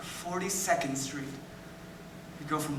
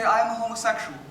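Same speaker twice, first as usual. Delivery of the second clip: higher, emphatic retold announcement; retold declaration; 4 semitones higher, 4 dB louder than usual. [name] recalls confessing to the principal, retold declaration.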